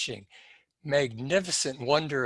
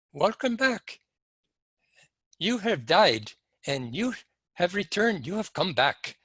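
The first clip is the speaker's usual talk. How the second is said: imitated Indian accent, higher lilting voice; Indian doctor; 5 semitones higher than usual. Doctor to revived patient standing over him, imitated Indian accent. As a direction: play an Indian doctor with a high, swooping tune. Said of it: "there was a Indian doctor standing over me".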